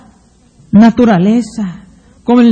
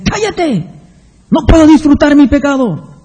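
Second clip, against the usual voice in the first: higher, snapping at his own conscience; irritated sinner; 4 semitones higher than usual. Acted. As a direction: brush off the inner voice, annoyed. Sinner voiced silencing his conscience.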